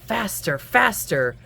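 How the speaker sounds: ordinary delivery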